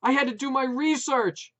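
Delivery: high, loud shout. A narrator's reading. Wife shouting news about the new dog, loud. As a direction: yell it over the dogs. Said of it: "Maureen shouted again".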